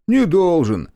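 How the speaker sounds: high, loud reply through a smirk. A narrator's reading. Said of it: "с ухмылкой ответил он". (с ухмылкой, высоким голосом)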